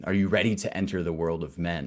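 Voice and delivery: ordinary talk, nothing marked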